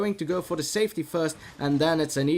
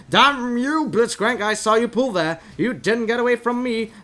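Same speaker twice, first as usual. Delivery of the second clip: shouting, high and loud; furious shout; 9 semitones higher, 8 dB louder than usual. Acted. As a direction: yell at him like he betrayed you. Caster yelling at Blitzcrank.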